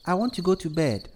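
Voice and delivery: put-on high-pitched little girl voice; child's request. A narrator's reading. Birthday girl asking mother for bed, childlike.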